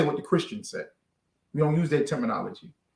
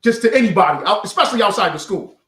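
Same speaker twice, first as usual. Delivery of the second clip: ordinary talk, nothing marked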